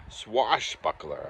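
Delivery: "Swashbuckler" said very slowly with a clear s-w start; deliberate slow fix of "Swashbuckler"; careful correction. Real, not acted. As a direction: spell out "Swashbuckler" slowly, syllable by syllable. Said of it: "I said it again wrong".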